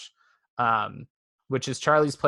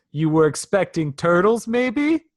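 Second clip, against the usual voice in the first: lightly acted wisecrack, louder, rising high at the end; Moto; 5 semitones higher, 6 dB louder than usual. Imitating a cartoon mouse's aside to camera, mock wisecrack. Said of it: "Moto looks at the camera and goes".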